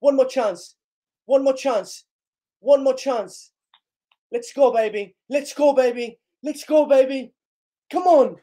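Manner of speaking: high, loud repeated pleas; will the team on, call after call; excited urging. Real, not acted.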